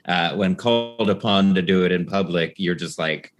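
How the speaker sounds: normal talking voice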